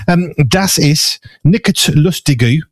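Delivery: das ist nicht lustig said slowly and carefully with English vowels; slow German read-out. Retold or imitated. Host reads co-host's German aloud, slow and careful. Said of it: "Why go for that".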